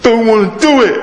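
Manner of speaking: high sulky schoolboy whine; mock sulky child refusing; whiny boy. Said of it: "His voice went".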